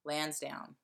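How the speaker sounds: LANSDOWNE with a hard, strong A in LANS. Shown as a demonstration. Explaining her hometown's name, hard A in LANS. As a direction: lean on the A of LANSDOWNE. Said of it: "it's a really hard A".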